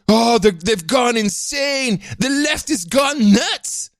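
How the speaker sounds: high, drawn-out mock outcry; mock alarm. Host mimicking YouTubers who took the clip seriously, mock alarm. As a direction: cry it out like an outraged commentator. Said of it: "who also took this seriously".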